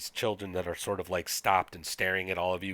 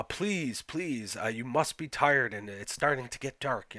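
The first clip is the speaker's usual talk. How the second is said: softly spoken, smug Scandinavian host, pitched higher; Jens; 5 semitones higher than usual. Acted. Smug village leader urges tired travelers in, higher soft-spoken fussing. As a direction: fuss over the guests, higher and syrupy-nice. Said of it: "softly spoken, smug, Scandinavian".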